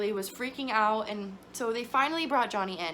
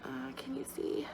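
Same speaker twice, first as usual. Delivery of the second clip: whisper; 4 semitones higher, 7 dB quieter than usual. Real, not acted. Baby asleep on monitor.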